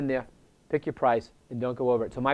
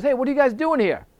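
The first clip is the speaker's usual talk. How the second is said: higher, louder challenge; indignant shout; 11 semitones higher, 5 dB louder than usual. Retold or imitated. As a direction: call out the intruders sharply. Angry owner confronts painters.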